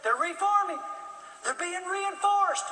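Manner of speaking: a hint of an anxious kid lookout; young lookout. Boy lookout warning the colonel, a hint of high boyish voice. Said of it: "The twelve-year-old lookout said".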